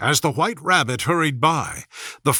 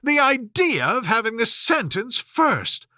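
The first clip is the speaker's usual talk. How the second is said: indignant Alice; 6 semitones higher than usual. A narrator's reading. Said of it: "cried Alice loudly".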